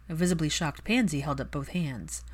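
ordinary delivery, nothing marked

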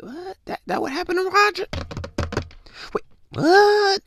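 high, squeaky, panicked double take; shocked disbelief. Acted. Rereading his text, high shocked disbelief. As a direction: yelp it out in total shock.

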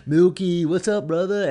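loud, high put-on hype voice; fake hype greeting. A streamer putting on a fake voice for viewers, loud hype greeting. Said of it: "talking like they don't normally talk".

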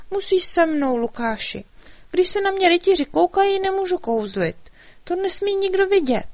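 high, eager fairy voice; little fairy, worried. A narrator's reading. Fairy explaining the king's trouble to the dragon, high and urgent.

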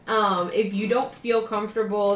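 normal talking voice

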